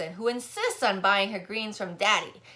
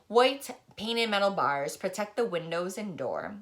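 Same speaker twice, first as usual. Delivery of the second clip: plain reading voice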